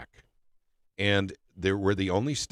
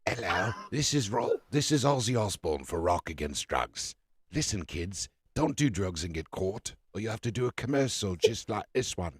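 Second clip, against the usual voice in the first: slurred British rocker voice; loose, mumbled British celebrity PSA. Acted. Podcast host jokes with a public service announcement, mock slurred British rocker. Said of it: "it's like [name] doing a public service announcement".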